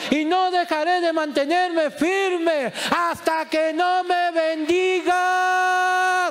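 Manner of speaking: high, slow, sustained shouting plea; desperate shout. Retold or imitated. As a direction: cry out with everything, refusing to stop. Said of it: "seguía gritando más fuerte".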